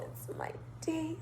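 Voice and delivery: breathless whispered delight. Retold or imitated. To herself in the red Benz, a whisper. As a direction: whisper it to yourself, thrilled. Gleeful private reaction in car.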